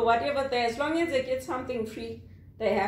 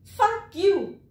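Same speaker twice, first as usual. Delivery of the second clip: higher mock outburst; angry customer curse; 6 semitones higher, 4 dB louder than usual. North American customer cursing a call-center worker, acted angry outburst. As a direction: imitate an irate customer cursing. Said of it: "That's how they talk".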